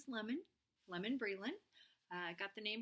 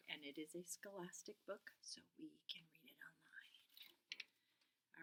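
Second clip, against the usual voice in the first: whisper; 3 semitones lower, 12 dB quieter, slower than usual. Real, not acted. Aside about the book.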